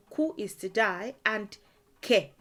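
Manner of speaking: ordinary talk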